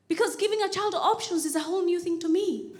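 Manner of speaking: normal talking voice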